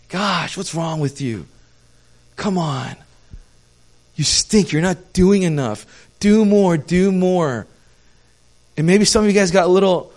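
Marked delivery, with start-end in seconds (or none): 0.1-7.7 mock nagging. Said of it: "you felt like God was saying to you through me".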